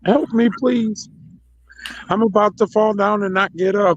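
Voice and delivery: high-pitched pretend pleading; squeaky mock damsel in distress; mock plea for help. Mocking caller begging for help.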